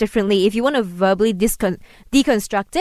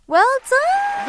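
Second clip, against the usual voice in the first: excited praise. Real, not acted. Teacher praising student's Mandarin.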